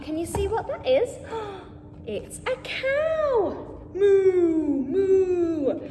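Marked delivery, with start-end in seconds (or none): 2.1-3.8 excited reveal. Acted. Reading farm book, reveals cow. 4.0-5.8 loud drawn moo like a cow. Acted. Reading farm book, cow speaks.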